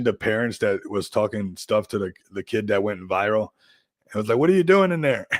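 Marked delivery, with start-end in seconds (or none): 4.4-5.3 high, louder mock-parent question; imitated baffled parent. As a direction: call it out like a bewildered parent.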